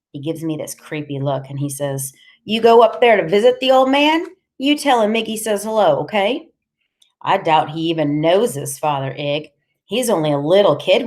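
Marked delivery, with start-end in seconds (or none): 2.4-6.5 high, loud, pushy character voice; menacing Iggy. Acted. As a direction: deliver a pointed message, raised and insistent.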